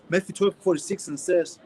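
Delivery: ordinary talk, nothing marked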